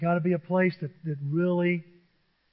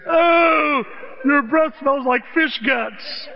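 put-on high, loud kid's whine, then slow disgust; Chunk from Goonies; 8 semitones higher, 5 dB louder, much slower than usual. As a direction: act out the kid grossed out by bad breath. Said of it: "that kid in Goonies and Chunk".

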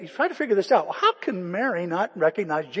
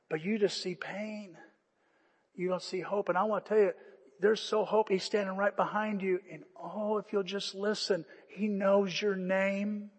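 much softer, intimate invitation; tender appeal; 7 dB quieter than usual. Acted. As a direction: speak softly to the hurting listener. Tender altar-call appeal.

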